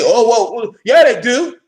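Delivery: slow, whiny mock protest; hammed-up defensive voice; mocking objector. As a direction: parody the doubters' excuse. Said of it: "Y'all can say".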